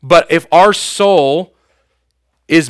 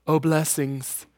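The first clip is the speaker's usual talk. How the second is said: soft, hushed giveaway gush; a light mock talk-show host handing out gifts; Oprah impression; 9 dB quieter, slower than usual. Lightly imitating a talk show host showering the audience, hushed. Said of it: "God's not Oprah".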